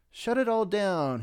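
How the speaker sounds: mock shout, pitched high. Rob McClure calls off the games with a high shout. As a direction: bark the order up high. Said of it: "he shouted quite loud".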